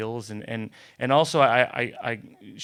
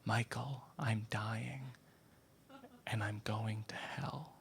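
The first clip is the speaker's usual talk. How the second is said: whisper; 12 dB quieter, slower than usual. Retold or imitated. Mother confides daily to son.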